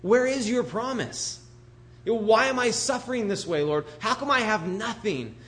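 acted exasperated lament; anguished questioning. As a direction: cry out in doubt, demanding answers from the Lord. Voicing a doubter crying out to God.

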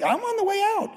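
landlord's realisation. Retold or imitated. Landlord's realisation voiced mid-sermon.

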